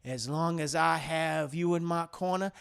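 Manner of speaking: calm him down tenderly; gentle reassurance. Retold or imitated. Big Mike soothing coach.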